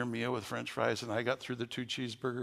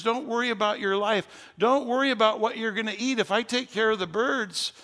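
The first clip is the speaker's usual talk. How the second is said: high, loud preaching of God's comfort; 7 semitones higher, 4 dB louder than usual. Retold or imitated. Preacher voicing God to an anxious congregation, loud and high. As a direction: proclaim it at full force, lifted high. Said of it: "And he says".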